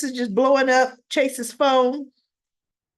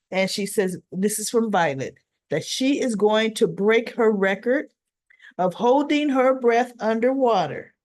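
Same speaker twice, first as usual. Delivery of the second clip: ordinary delivery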